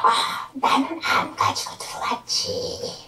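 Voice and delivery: hissy, slow whispered demon; demon's voice. Retold or imitated. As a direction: imitate a demon answering in a drawn-out whisper. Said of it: "저기 귀신이".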